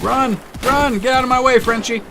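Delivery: high frantic shouts to flee; panicked yelling. Pretend. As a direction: yell at them to run for it. Fleeing Germans in game.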